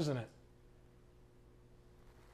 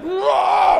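high, loud mock roar; indignant roar; 13 semitones higher, 10 dB louder than usual. Pastor imitating an indignant deacon, loud roar. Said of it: "started getting all indignant".

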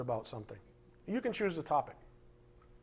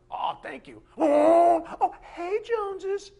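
high, loud gushing thank-you, hammed up; mock excited gushing; 11 semitones higher, 7 dB louder, slower than usual. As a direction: overdo the delighted fake gratitude. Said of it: "It's like".

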